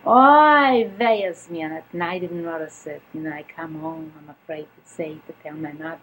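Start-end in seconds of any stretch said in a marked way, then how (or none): 0.1-1.6 dismayed lament. Retold or imitated. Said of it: "dismay at broken watch".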